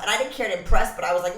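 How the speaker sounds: ordinary talk, nothing marked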